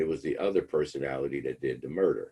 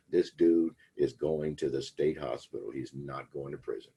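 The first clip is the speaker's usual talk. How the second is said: ordinary delivery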